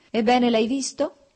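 venomous smile. A narrator's reading. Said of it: "con un sorriso velenoso".

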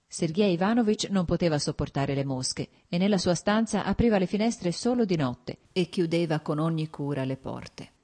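plain reading voice

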